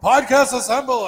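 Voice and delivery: high, drawn-out mock summons; mock rallying call. Friend imitating his drunk co-host summoning the podcast, mock rallying call. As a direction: call it out like assembling a superhero team. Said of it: "being like".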